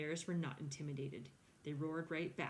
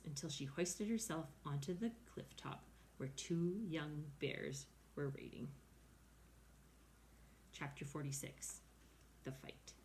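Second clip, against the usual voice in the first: usual speaking voice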